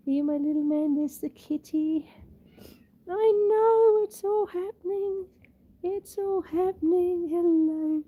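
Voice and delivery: high, cooing pet voice; pet talk. Real, not acted. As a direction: fuss lovingly over a little pet. Fussing over her pet.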